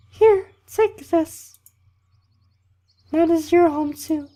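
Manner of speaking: slow, very high falsetto camp leader; loud, drawn-out mock female falsetto; bad girl voice. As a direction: hand it over in a badly faked woman's voice. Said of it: "That was a really bad girl voice".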